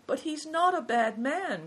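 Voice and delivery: high-pitched, earnest outcry; crying out. A narrator's reading. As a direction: leap up high in protest on someone's behalf.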